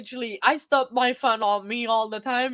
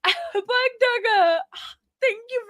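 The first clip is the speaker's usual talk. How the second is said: tearful; 9 semitones higher, 3 dB louder than usual. Real, not acted. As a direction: call the name through real tears. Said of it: "like, ugly crying".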